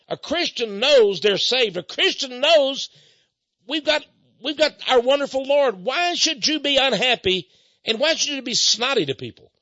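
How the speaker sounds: preaching scold. Retold or imitated. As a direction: preach at them with rising fervor.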